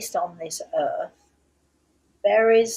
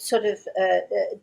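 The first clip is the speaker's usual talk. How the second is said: ordinary talk, nothing marked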